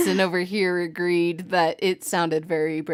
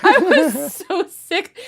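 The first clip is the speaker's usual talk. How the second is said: laughing; 10 semitones higher, 5 dB louder, slower than usual. Real, not acted. Laughing at her own story.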